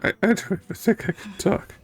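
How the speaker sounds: ordinary talk, nothing marked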